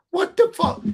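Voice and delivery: high, incredulous what the fuck; retold disbelief. Brock reacts in disbelief.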